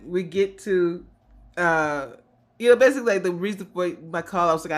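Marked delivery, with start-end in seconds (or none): none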